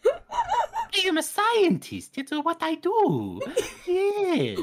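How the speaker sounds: high, slow, laughing villain voice; Chamberlain voice. Pretend. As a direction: squeak it out through laughter. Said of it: "I can also do it as the Chamberlain".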